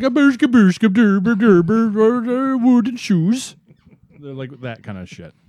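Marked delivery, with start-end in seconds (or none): 0.0-3.6 high, loud nonsense babble in a fake European accent; mock Europeans with goofy gibberish. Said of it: "No, they're like".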